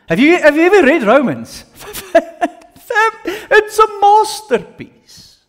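rising high and loud with delight; excited. Real, not acted. Teacher gushing to students about Romans, excited and loud. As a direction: gush about the masterpiece with a laugh.

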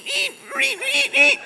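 high squeaky eat eat like rats; rat squeaks. Retold or imitated. Pastor mimics rats squeaking in the rafters, high squeaks.

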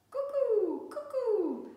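high, loud cuckoo clock call. A narrator's reading. Cuckoo clock calling at night, high loud cuckoo.

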